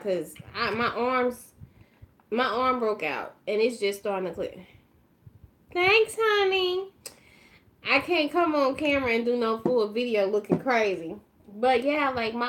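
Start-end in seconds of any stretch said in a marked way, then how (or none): 5.7-6.9 high, drawn-out sweet thank-you; slow, lifted, affectionate call. Real, not acted. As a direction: call it sweetly across the room. Calls thanks to someone off camera.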